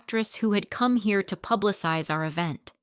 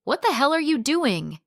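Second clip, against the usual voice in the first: angry outburst; 8 semitones higher, 5 dB louder than usual. Acted. Rushing in to break up fight.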